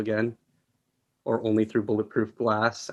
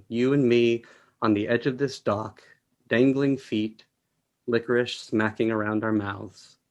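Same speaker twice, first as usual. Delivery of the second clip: plain reading voice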